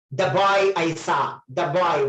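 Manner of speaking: put-on "the" said "duh" before boy; short open vowel in "the". Shown as a demonstration. Teacher showing the consonant rule to students, "the" pronounced "duh". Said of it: "Why did I say the?".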